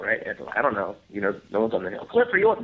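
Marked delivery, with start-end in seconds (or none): 2.1-2.6 calling out a name. Retold or imitated. Crew called out at night.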